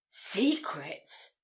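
startled exclamation, drawn up and falling; exclaiming. A narrator's reading. Young woman echoing his word about secrets, exclaiming. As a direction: exclaim it in mild astonishment. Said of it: "she exclaimed".

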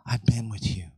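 hushed whisper, tender; soft reassuring whisper. Acted. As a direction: breathe it as a secret comfort. Whispered close of God's words.

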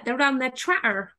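broad rural Somerset burr; Somerset accent. Shown as a demonstration. Guest mimicking locals from home, in a Somerset accent. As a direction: put on a Somerset country accent. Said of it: "so they all talk like this".

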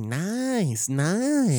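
savoring nice, nice in a put-on voice; mock catchphrase. Host copying co-host's catchphrase, amused. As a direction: roll the catchphrase around. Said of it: "Rolls off the tongue quite nicely".

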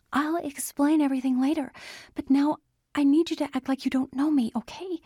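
hurried plea. Acted. Andy pleading, hurried.

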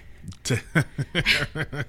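amused burst of laughter; a run of chuckles. Real, not acted. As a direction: laugh through the other person's struggle. Laughs as cohost struggles to speak.

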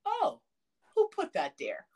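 high oh, then back down, innocent; sudden return to calm. Retold or imitated. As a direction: imitate grandpa's oblivious surprise. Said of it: "from zero to a hundred to zero again".

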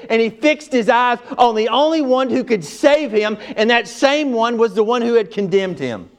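high, loud, rising preacher's cry; full-force preaching. Acted. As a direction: preach it out to the whole room.

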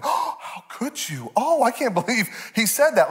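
hammed-up horror in a high voice; mock scandalized. Imitating son shocked at a bad word, mock scandalized. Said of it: "You're clutching your pearls".

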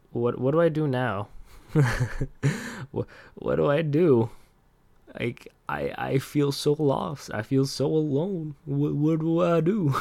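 high, whiny pretend despair with laughs between; mock lost and helpless. Missing her favorite coworker, hammed-up high whimper. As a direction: ham up feeling lost and alone.

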